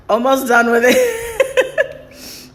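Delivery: high, slow laugh-through. Real, not acted. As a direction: giggle through the words. Laughing through spicy noodles.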